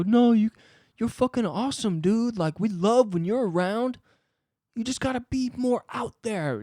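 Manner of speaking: mock encouragement. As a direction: gush like a condescending mentor.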